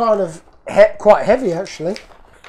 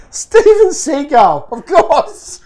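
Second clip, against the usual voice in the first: amused realization; 7 semitones higher, 4 dB louder, slower than usual. Real, not acted. Reacts to seagull name, laughing.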